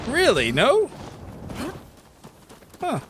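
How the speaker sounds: high rising disbelieving questions; surprised. Real, not acted. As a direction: shoot both questions way up in disbelief.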